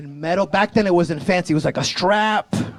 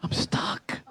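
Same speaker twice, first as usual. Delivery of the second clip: whispered, much softer; frozen whisper; 10 dB quieter than usual. Retold or imitated. At the cliff edge, frozen, whispered. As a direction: barely get it out, frozen with fear.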